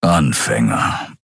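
low, slow lion rumble; a gruff lion-man dismissing the attackers; gruff grumble. A narrator's reading. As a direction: growl the word out slow and low. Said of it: "brummte Keru".

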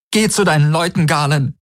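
high, wounded dismissal; raw hurt. Acted. Betrayed woman sends her lover away, raw hurt. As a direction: send him away with pain in the voice. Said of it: "Ihre Stimme war wie eine rohe Wunde".

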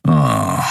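low, scornful sigh; deep dismissive groan; contemptuous "ah". A narrator's reading. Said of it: "hörte ein verächtliches".

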